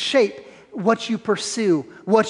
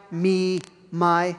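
ordinary talk

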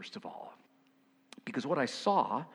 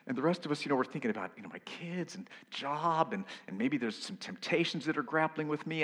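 usual speaking voice

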